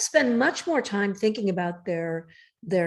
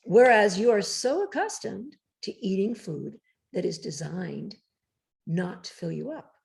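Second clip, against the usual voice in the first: ordinary talk, nothing marked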